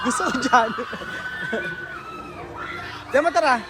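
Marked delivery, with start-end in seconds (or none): 0.7-3.1 very high shrieking scream; screaming laugh. Real, not acted. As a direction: let out a thrilled shriek. Screaming on a ride.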